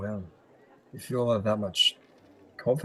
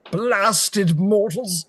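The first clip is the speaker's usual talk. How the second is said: slow, drawn-out, higher and louder curse; growling mind flayers; 9 semitones higher, 5 dB louder, much slower than usual. Acted. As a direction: stretch out each syllable with creature disdain. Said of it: "they're basically saying".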